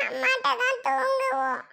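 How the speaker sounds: put-on high, whiny child voice; insistent child. Child insisting to aunt on sleeping with mother, whining.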